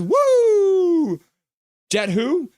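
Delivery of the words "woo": high, loud fan's whoop. Retold or imitated. Voicing a chatter's cheer, a loud high whoop. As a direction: let out a big high woo. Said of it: "And then G T City says".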